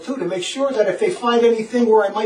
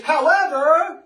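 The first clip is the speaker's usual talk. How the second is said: raised, lingering HOWEVER; drawn-out caveat; 6 semitones higher, much slower than usual. Retold or imitated. Reading a suspicious catechism caveat, HOWEVER drawn out high. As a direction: stretch HOWEVER slowly, pitched high.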